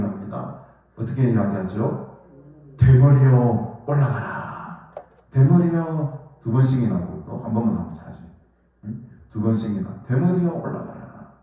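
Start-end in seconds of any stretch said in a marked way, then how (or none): 2.8-4.9 loud, drawn-out jeer; stretched, falling taunt; mocking taunt. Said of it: "놀리는 것은". 5.3-6.2 high, loud jeer; teasing cry, pitched up; mocking taunt. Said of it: "놀리는 것은". 10.1-11.3 high-pitched teasing call; acted taunt, falling at the end; mocking taunt. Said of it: "놀리는 것은".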